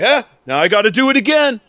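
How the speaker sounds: high loud fast agent voice; mock TSA guy. TSA guy to the traveler, high fast mockery. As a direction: rattle it off as the dim agent.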